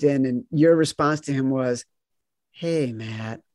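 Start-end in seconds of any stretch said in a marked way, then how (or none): 2.5-3.4 hushed mournful greeting; somber. Retold or imitated. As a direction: greet him like at a funeral. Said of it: "as if, like, he was in mourning".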